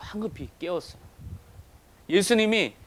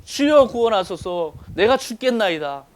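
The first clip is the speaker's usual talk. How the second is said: loud, high, drawn-out cry to the Lord; acted pulpit cry for salvation; desperate plea; 5 semitones higher, 8 dB louder than usual. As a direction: beg for rescue with slow, heavy urgency. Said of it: "우리는 주님께 구하죠".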